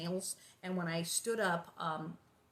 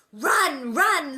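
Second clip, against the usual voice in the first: loud, high shout to run; screamed run; 7 semitones higher, 13 dB louder than usual. A narrator's reading. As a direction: yell a fake warning. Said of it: "Laura screamed".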